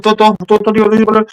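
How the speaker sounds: high-pitched mock Dutch babble; meek cashier girl. Cashier answering the boss in Dutch, high babbled imitation. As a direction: imitate a girl's reply in high gibberish. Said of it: "and she's like".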